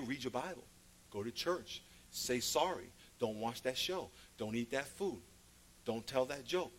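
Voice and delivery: soft, low inner prompting; voice of conscience. Retold or imitated. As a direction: list it quietly, like thoughts nudging. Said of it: "sound like your conscience".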